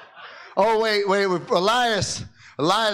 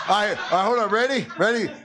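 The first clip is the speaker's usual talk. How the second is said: ordinary delivery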